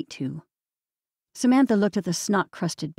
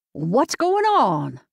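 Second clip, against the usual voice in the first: very slow, higher Southern ask; slow Southern accent; 4 semitones higher, 3 dB louder, much slower than usual. A narrator's reading. Older Southern woman asks sisters, slow Southern accent. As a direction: drawl out a motherly Southern question. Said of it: "her slow Southern accent".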